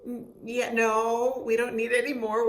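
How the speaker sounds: hammed-up hurried refusal; retold protest. Quoting themselves to doctor.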